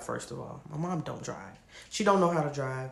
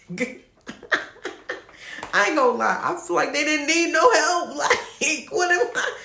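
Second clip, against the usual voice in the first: high, loud laughing talk; giggling disbelief at friends fighting; 11 semitones higher, 6 dB louder than usual. Real, not acted. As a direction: laugh through the line.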